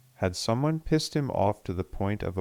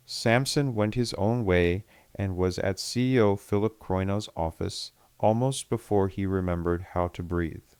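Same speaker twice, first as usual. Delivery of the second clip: ordinary delivery, nothing marked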